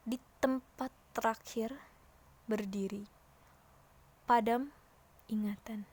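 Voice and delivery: "Di tempat terakhir", "berdiri", "padam", "ingatan" set apart by pauses; stress on each phrase. Shown as a demonstration. Teacher demonstrating poem phrasing, stress and pause on "terakhir", "berdiri", "padam", "ingatan". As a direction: weigh each chunk, stopping after "terakhir", "berdiri" and "padam". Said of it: "Harus ada tekanannya di setiap penggalan kata".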